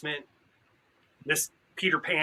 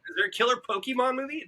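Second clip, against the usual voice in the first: high, quick disbelieving question; surprised; 10 semitones higher, faster than usual. Real, not acted. Friend asking in surprise about a killer Pokemon movie, surprised. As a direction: blurt it out in surprise.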